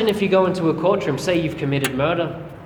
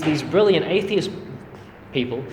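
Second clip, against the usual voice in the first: ordinary talk, nothing marked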